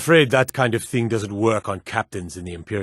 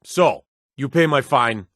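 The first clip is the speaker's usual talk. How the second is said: normal talking voice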